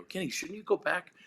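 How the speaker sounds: high, amused question over the noise; half-yelled question. Retold or imitated. Telling the drummer mid-show to return, high teasing. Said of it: "and I go".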